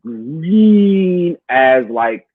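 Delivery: very slow, stretching out green; drawn-out emphasis. Real, not acted. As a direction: drag the words to show how raw they were. Stressing how new they were.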